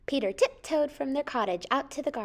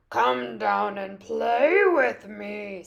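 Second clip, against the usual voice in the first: slow, drawn-out coaxing wolf voice; falsely friendly wolf; 4 dB louder, much slower than usual. A narrator's reading. Wolf coaxes the cat down, slow fake friendliness. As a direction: lure slyly, stretching the words out. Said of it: "pretending to be friendly".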